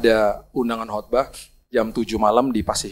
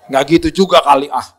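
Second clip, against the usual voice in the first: louder, laughing protest; laughing retort; 8 dB louder than usual. Retold or imitated. Husband laughing back at his wife's joke.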